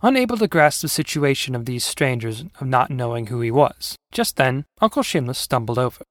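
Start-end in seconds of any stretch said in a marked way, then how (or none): none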